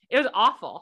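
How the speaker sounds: ordinary talk, nothing marked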